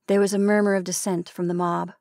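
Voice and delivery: ordinary delivery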